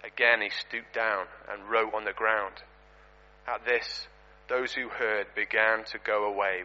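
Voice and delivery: measured scripture reading. Acted. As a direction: read the passage gently and reverently. Reads scripture passage aloud.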